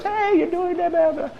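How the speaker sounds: tiny high, soft voice; squeaky little voice of conscience, hurried. Acted.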